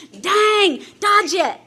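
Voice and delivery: sudden loud self-warning, pitch up; tense inner warning. Retold or imitated. To herself passing beer ads, loud inner warning. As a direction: snap at yourself to look away. Self-talk resisting temptation, retold.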